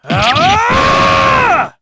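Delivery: long high loud scream; shrill scream. Acted. Sloth screams.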